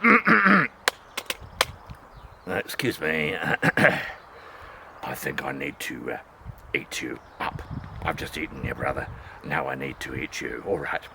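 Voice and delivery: very low, soft, mock-courteous wolf; a deep-voiced wolf apologising before eating; low polite wolf. Wolf politely threatening second pig.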